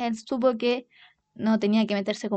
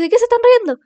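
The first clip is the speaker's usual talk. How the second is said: excited shout; 11 semitones higher, 9 dB louder, faster than usual. Retold or imitated. Runs in shouting to sisters.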